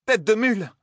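high furious cry; furious exclamation. A narrator's reading. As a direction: snap it out in fury. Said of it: "s'écria Selene".

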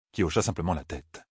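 ordinary delivery

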